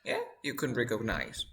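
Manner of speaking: usual speaking voice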